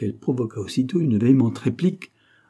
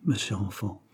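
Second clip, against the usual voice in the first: ordinary delivery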